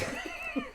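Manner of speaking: a high breathless squeak of a laugh; high squeal laugh. Real, not acted.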